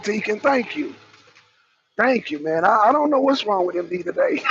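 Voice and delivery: ordinary delivery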